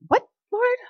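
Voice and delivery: surprised. Retold or imitated. Surprised at tithe command.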